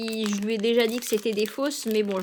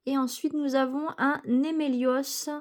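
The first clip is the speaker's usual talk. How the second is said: ordinary delivery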